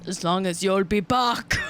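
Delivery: hammed-up Austrian action-hero accent; Arnold Schwarzenegger. Shown as a demonstration. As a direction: deliver it as a mock movie catchphrase. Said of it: "let's hear the Schwarzenegger impression".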